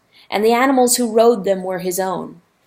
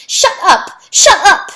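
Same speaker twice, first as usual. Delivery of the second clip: much higher, loud desperate yell; desperate shout; 11 semitones higher, 9 dB louder than usual. Acted. Rooster silencing the river, desperate shout. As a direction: scream it to silence him. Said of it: "shouted the rooster, suddenly desperate".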